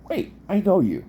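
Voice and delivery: sudden high surprised recognition. Retold or imitated. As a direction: blurt it out, suddenly recognizing him. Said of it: "I went".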